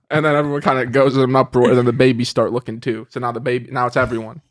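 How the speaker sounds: ordinary talk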